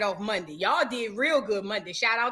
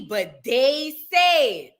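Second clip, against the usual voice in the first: but they... said, dragged out slowly; slow drawn-out gossip; much slower than usual. Shown as a demonstration. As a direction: stretch it out like juicy gossip. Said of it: "I didn't say it".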